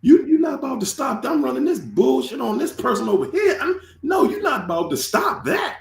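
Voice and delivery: high, mocking taunt voice, voice breaking; mocking the haters. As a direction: sneer it like a bully blocking you. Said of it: "They like".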